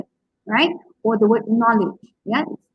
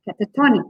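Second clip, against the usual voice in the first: cata-TON-ic, TON hit strongly; stress on second-last syllable; faster than usual. Shown as a demonstration. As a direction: punch the TON in catatonic. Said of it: "second last syllable is stressed".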